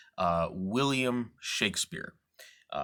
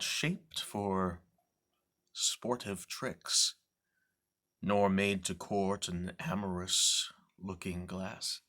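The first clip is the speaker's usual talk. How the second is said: brooding recitation. Acted. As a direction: confide his bitterness to the audience, hushed. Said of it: "I'm going to do a reading".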